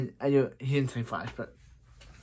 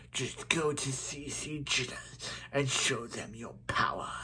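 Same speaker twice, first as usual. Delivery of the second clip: Zoom voice; 11 dB quieter than usual. Acted. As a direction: draw it out softly like a sinister villain. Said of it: "I do a very good Zoom voice".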